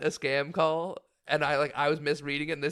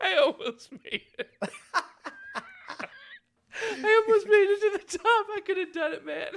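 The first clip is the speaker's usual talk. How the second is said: laughing mock lament; slower than usual. Real, not acted.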